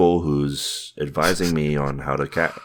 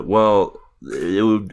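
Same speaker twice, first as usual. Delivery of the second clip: usual speaking voice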